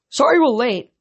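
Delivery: a moderate shout; calling across crowd. A narrator's reading. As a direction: call it over the noise. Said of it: "Chiron shouted across the sea".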